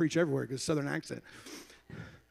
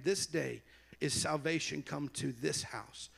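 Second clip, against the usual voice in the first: softer, measured Bible reading; solemn scripture reading; 5 dB quieter than usual. Real, not acted. Preacher reading Zacchaeus's words to church, solemn measured reading. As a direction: read the verses quietly and deliberately. Reads scripture aloud to congregation.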